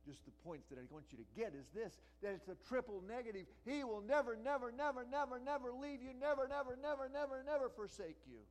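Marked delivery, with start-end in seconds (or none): 3.6-8.4 high, loud, every never hammered; fiery preacher building repetition; emphatic repetition. Shown as a demonstration. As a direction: pound each never like a drumbeat. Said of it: "He is emphatic about it".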